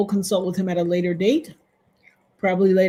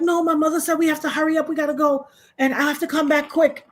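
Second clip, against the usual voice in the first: higher, fast, hurried friend; friend's hurried voice; 7 semitones higher than usual. Retold or imitated. Friend insisting they hurry up, higher and fast. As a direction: rush it like an impatient friend. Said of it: "And she was like".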